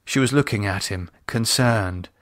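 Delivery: plain reading voice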